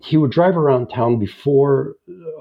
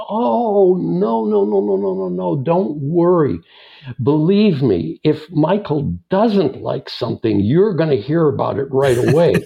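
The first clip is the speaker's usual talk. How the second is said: higher, eager 'no no no' to calm him down; emphatic reassurance; 5 semitones higher than usual. Retold or imitated. Office contact reassuring him, higher and eager. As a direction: pile on the no's, brushing the worry aside.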